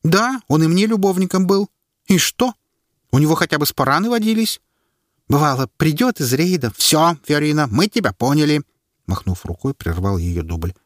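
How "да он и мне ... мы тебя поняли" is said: high, lightly voiced woman speaking up; woman's voice. A narrator's reading. Woman defending her lover before the crowd, lightly voiced. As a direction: Fiorina voiced a touch higher.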